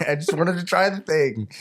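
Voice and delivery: high, half-laughing protest; mock-aggrieved plea. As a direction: plead it like a wronged customer.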